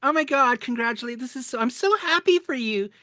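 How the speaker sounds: parroting the friends' loud delighted congratulations; mock gushing congratulations. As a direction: ham up fake over-happy well-wishers. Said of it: "people are like".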